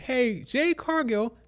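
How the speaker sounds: mock squeaky shout, high and slow; imitating a shrill heel calling out a rival; squeaky screaming Tiffany. Mocking Tiffany calling Jade out, high squeaky yell. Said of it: "she's very squeaky".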